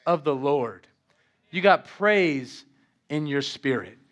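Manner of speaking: normal talking voice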